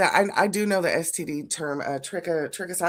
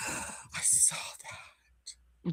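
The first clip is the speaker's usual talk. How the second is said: gasping whisper; 17 dB quieter, slower than usual. Real, not acted. Gasps at the ringworm-like STD.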